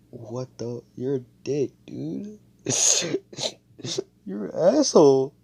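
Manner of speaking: high, whimpering fake cry; hurt and tearing up, hammed-up, ending loud; comically heartbroken blubber; mock tearful. Retold heartbroken man tearing up.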